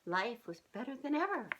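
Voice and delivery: usual speaking voice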